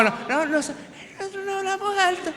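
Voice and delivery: imitating his wife claiming she never shouts; mock soft-spoken wife. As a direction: play the innocent quiet wife. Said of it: "la única dominicana que no habla alto".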